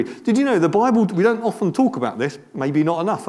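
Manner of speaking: normal talking voice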